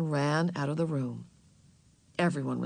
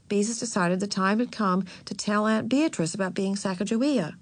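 plain reading voice

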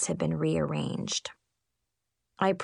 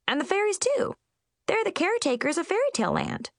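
high, fast Munchkin chatter; 12 semitones higher, faster than usual. Acted. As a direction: rattle it off eagerly, high-pitched. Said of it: "explained Chip".

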